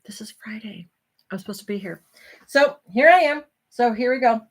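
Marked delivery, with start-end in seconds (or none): 0.0-2.0 soft unvoiced whisper of realisation; whispered aside. Real, not acted. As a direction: breathe it as a secret aside. Remembers it's live night.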